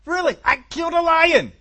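put-on high, loud protest from young David; excited boast. Young David tells his doubting family of his kill, excited boast.

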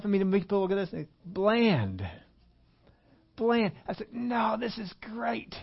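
high-pitched, hammed-up relish, words stretched out; gushing enthusiasm. Preacher defending his bland cereal to the congregation, gushing.

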